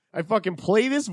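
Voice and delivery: normal talking voice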